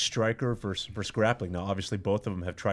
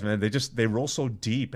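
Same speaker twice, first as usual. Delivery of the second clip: ordinary delivery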